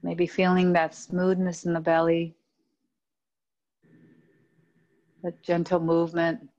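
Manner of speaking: calm, even yoga nidra voice with long pauses; steady, low-key meditation guide, phrase by phrase; guided meditation. Acted. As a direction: lead them inward, unhurried, letting each phrase rest.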